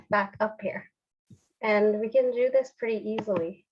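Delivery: ordinary talk, nothing marked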